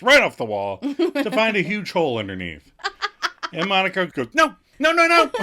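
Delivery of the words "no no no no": high, loud, rapid no's; panicked protest. Retold or imitated. Monica trying to stop Rachel from ripping the drawings, in a high, loud panic. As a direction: stop her in a panic, voice jumping up.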